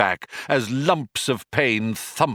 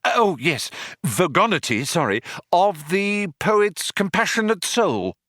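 higher, Vogonity said firmly with relief; relieved Arthur; 10 semitones higher than usual. Acted. Arthur repeats Ford's prompt Vogonity eagerly. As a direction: seize Vogonity gratefully.

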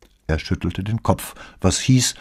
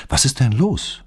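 worried question, pitched up; sincerely concerned; 7 semitones higher than usual. A narrator's reading. Paola hears her acquaintance is in hospital, sincerely concerned. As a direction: ask with honest concern. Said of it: "fragte Paola ehrlich besorgt".

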